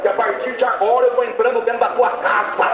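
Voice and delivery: high-pitched, insistent pulpit proclamation; prophetic preaching. Retold or imitated. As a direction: proclaim it high and forceful, as a message from God. Preacher delivers God's message.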